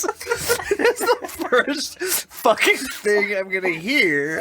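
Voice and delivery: high, slow, laughing words; laughing through pain. Real, not acted. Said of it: "that hurts so bad".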